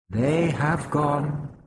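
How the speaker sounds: slow, stilted winged alien; high stilted alien voice. Acted. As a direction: place each word carefully, like a foreign creature. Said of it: "The voice was high-pitched, the accent stilted".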